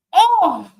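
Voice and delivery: high, loud "oh" at a chance; real burst of match excitement; excited cry. Real, not acted. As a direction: cry out at a near miss. Near chance in live match.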